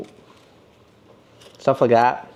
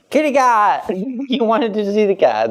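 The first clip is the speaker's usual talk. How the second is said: excited gasp; 8 semitones higher than usual. Real, not acted. Gasps, delighted to see cat.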